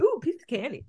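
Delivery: soft mock voice of herself eating candy; mimicking herself. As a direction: tease your own candy line, hushed and put-on. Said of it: "That's me".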